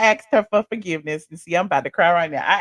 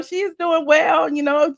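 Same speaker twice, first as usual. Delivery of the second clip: ordinary delivery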